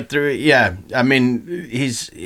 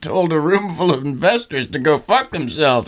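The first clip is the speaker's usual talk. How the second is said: higher, mock-polite room of investors; 6 semitones higher than usual. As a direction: play the stunned investors answering politely. Mock investors voiced to Elon.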